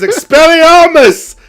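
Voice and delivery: loud, high, slow spell cry; shouted spell. Retold or imitated. Shakespeare casting the banishing spell in a loud, high cry. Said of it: "And then says".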